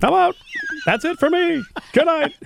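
high, laughing sitcom sign-off; Seinfeld impression. Cohost joining the Seinfeld bit, laughing mock exit. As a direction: ham up the exit line with a laugh. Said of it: "Like, like Seinfeld".

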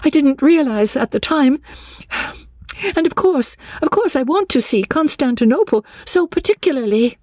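high, agitated pleading; frantic edge in a high voice; near-hysterical excitement. A narrator's reading. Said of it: "She ran her fingers hysterically".

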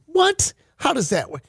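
high, incredulous opening, then eager request; mock-puzzled act; feigned curiosity. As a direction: play dumb and fascinated. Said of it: "And the man pretended to".

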